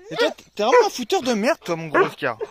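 loud teasing scold at the dog. Real, not acted. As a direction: tell off the naughty dog, loud and fond. (gronder gentiment le chien, fort)

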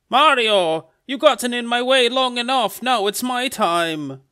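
loud, high confrontation, slowing at the end; Luigi. Acted. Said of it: "And then Luigi, also bloodied".

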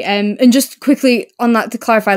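normal talking voice

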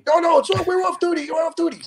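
high, insistent put-on voice; mock protest. Larry justifying the wine to the Duke, high pleading voice. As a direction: wave off the boss's worry. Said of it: "Larry reassuring the Duke".

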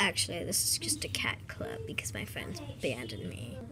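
sheepish whispered admission. Pretend. Cat club leader whispering why she started the club. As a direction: whisper the embarrassing truth.

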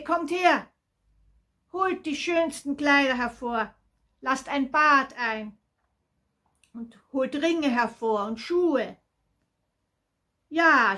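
high, loud, jubilant orders to servants; interrupting, overjoyed father. A narrator's reading. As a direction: cut in and rejoice loudly as the father. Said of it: "der Vater fiel ihm ins Wort". (freudig aufgeregt, laut)

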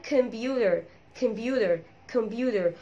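"كمبيوتر" with the T as a soft d, "kem-BYU-der"; American-style "كمبيوتر", d for t and a final r; computer with D. Shown as a demonstration. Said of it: "وممكن برضو تقولها بالدي".

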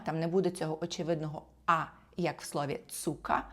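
ordinary talk

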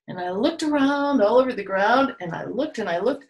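ordinary talk

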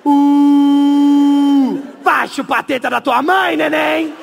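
loud mocking yell from the slide; heckling shout. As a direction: jeer at him across the park. Alguém gritando do escorregador. (grito zombeteiro de longe)

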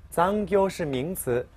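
normal talking voice

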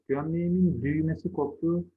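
usual speaking voice

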